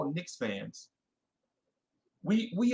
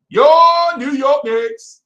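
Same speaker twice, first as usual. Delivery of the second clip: slow, much higher and louder ring-announcer call; mock announcer boom; 10 semitones higher, 12 dB louder, much slower than usual. Host mocking the Knicks' standing, booming announcer call. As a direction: announce them like a hammed-up introduction.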